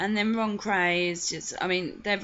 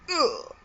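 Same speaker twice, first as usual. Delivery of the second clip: disgusted ugh; 8 semitones higher, 4 dB louder than usual. Real, not acted. Disgusted reaction to the quote.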